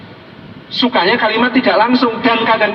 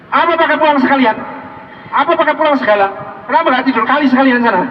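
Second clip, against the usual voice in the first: higher-pitched mock scolding; angry wife; 5 semitones higher, faster than usual. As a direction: scold a husband who came home late. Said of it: "Marah gitu".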